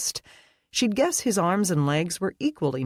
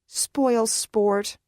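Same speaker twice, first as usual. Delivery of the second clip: higher, sulky grumble; Brittany grumbling; 8 semitones higher, 3 dB louder than usual. A narrator's reading. Brittany sulking after Leah's refusal, a higher grumble. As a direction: pout it like a thwarted friend. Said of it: "Brittany grumbled".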